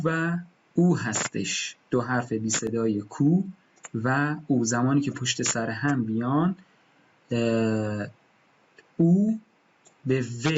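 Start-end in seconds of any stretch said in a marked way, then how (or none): none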